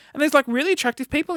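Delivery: ordinary talk, nothing marked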